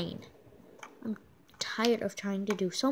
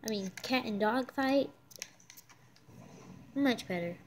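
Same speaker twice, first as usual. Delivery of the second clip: ordinary talk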